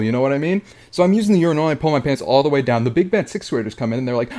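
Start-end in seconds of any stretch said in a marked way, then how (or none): none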